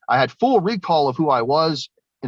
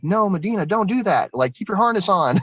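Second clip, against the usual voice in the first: higher, urgent shout to his sister; a brother pleading with his sister, pitched up; urgent warning; 9 semitones higher than usual. Retold or imitated. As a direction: brother warning Medina to keep her harness on, urgently. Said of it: "her brother was like".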